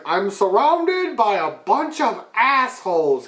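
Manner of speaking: whiny villain in a giant helmet; Rick Moranis voice. Retold or imitated. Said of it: "he's like".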